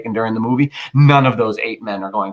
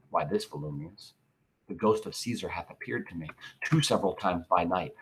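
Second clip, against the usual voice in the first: much softer, lower confession; hushed confiding; 5 semitones lower, 11 dB quieter than usual. Acted. Brutus telling Volumnius of Caesar's ghost, hushed and low.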